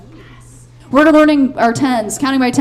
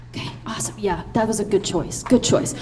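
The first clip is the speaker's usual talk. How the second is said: whispering; 6 semitones lower, 10 dB quieter than usual. Real, not acted. Whispered aside to child.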